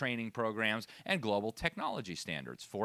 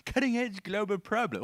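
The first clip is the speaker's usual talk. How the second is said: higher, mocking British accent on the phrase; 8 semitones higher, 3 dB louder than usual. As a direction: send up the clip in a British accent. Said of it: "And in that British accent".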